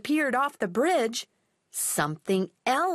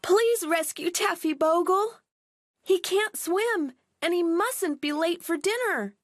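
high desperate plea; pleading cry; 7 semitones higher than usual. Acted. Girls beg the fisherman to save their dog, high plea. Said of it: "cried Millie and Molly".

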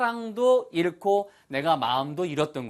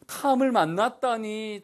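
amazed disbelief; 7 semitones higher, 3 dB louder than usual. Acted. Acting amazement at meeting Tom.

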